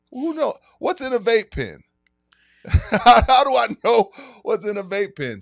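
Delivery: high, loud laughing rant; laughing disbelief. Real, not acted. Laughing at his own question to the host, high and loud. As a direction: crack up mid-question.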